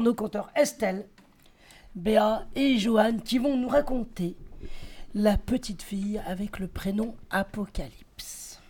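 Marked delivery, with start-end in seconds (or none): 5.1-8.6 dramatic title, last words whispered; epic fantasy narrator. Acted. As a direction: hush down into a mysterious whisper. Announcing the tale's title.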